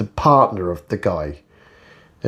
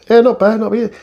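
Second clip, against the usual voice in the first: high, squeaky mock answer to a greeting; mock cheery reply; 12 semitones higher, 4 dB louder than usual. The vlogger parodies a customer answering his greeting, high and mocking.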